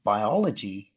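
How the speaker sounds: Biology said loud, bi and o kept apart; careful pronunciation. Shown as a demonstration. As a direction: stress Biology with two separate vowel sounds. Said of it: "careful here".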